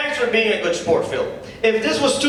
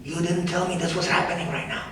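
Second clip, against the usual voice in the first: hushed aside; 3 semitones lower, 6 dB quieter, faster than usual. Acted. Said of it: "Mike leans over and whispers".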